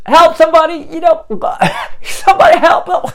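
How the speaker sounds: high, loud panicked cry for help. Acted. Drowning man calls to anyone nearby, crying for help. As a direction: yell out like someone drowning. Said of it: "crying for help".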